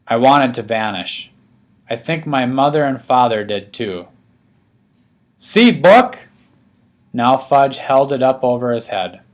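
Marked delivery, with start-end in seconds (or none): 5.4-6.3 put-on higher, louder toddler demanding attention; a small child pushing his book at everyone; insistent toddler. A narrator's reading.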